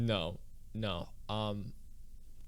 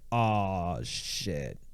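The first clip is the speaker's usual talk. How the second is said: low, drawn-out oh-no; dismayed dude; 5 semitones lower than usual. Pretend. Worker noticing his snack fell on the line, dismayed. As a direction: sink low on a sorry realization. Imagined factory worker's reaction.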